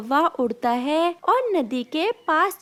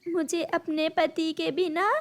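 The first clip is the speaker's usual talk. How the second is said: high, tearful voice with sad pauses; acted crying, halting and high; weeping widowed bird; 6 semitones higher than usual. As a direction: sob it out piece by piece, high-pitched. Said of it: "तुम क्यों रो रही हो".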